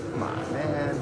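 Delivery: ordinary delivery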